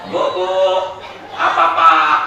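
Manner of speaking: loud call and answer; wife replies with a loud shout. Acted. Husband calls wife after bathing, loud call and reply.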